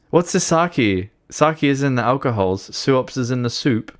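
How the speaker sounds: usual speaking voice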